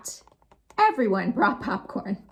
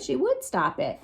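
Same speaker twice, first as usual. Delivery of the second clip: ordinary delivery, nothing marked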